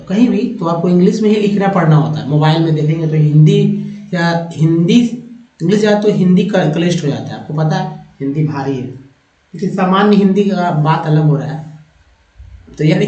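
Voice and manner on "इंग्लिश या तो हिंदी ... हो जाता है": usual speaking voice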